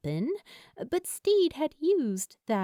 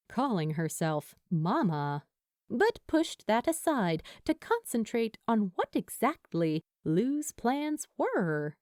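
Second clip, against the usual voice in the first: plain reading voice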